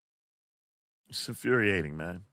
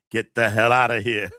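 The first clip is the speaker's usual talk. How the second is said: louder quoted outburst; loud quote; 5 dB louder than usual. Acted. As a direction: deliver a playful mock yell. Repeats the quote, laughing after.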